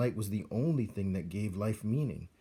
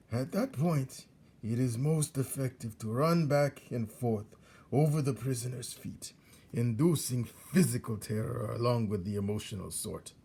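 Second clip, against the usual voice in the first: put-on old rat Botticelli; 3 semitones higher than usual. Old rat teaches young rat how to torture prisoners, gloating. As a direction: savour each cruel instruction.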